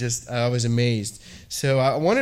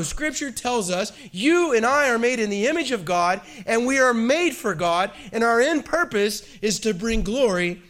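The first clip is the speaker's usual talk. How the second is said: raised, fervent pulpit voice; preaching; 7 semitones higher than usual. Real, not acted. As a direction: preach it with full conviction. Preaching on Christ in creation.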